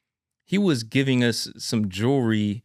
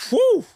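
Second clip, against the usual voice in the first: high startled whew, pitched way up; amazed whew; 4 dB louder than usual. Real, not acted. Son stunned by his father's words, high whew.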